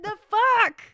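very high, very loud shout; imitated child's yell. Parent imitating a child mispronouncing truck, shouted. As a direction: blurt it at full volume, mimicking a kid. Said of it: "And she kept saying".

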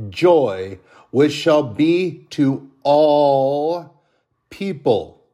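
preacher lifting the Christmas verse, loud; scripture reading. Acted. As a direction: read the angel's words with swelling awe. Reads scripture, moved and loud.

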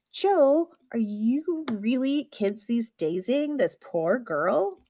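loud, high name, then drawn-out teasing reproach; exasperated groan. A narrator's reading. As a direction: groan at a colleague in slow disbelief. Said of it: "Somebody groans behind me".

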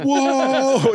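high, drawn-out awed whoa; mock stunned teen-movie whoa; awed Weird Science guys. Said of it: "like Weird Science guys or something".

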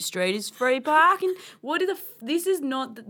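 Aussie read settling down from a rant; Aussie accent. Acted. Naming a favourite street in a chat, Aussie accent. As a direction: give the opinion in an Aussie accent. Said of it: "I reckon we should do this in Aussie".

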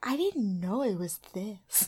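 hushed, whispery, solemn imitation; Tyra. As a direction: imitate overdone, hushed emotional concern. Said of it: "Tyra's like".

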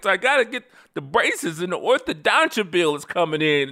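high-pitched, mounting comic rant with laughter; incredulous parent tallying up grievances; mock exasperated parent. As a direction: list every cost in hammed-up outrage.

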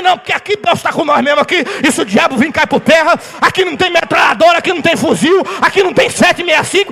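rising victorious declaration to a shout; triumphant proclamation. Retold or imitated. Father reassuring his daughter after the shooting, triumphant building shout.

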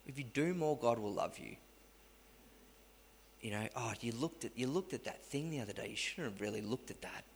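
hushed, needling accuser; the accusing devil on the phone to Liam, hushed; soft accusing voice. Retold or imitated. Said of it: "It's like".

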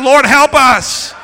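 loud, high, drawn-out shout; shouted plea. Shown as a demonstration. Pastor shouting a plea with the congregation, drawn-out shout. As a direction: shout it slowly with full force. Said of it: "with your pastor and shout".

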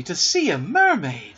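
wishful cry. A narrator's reading. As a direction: voice lifted in wonder. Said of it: "cried Wendy".

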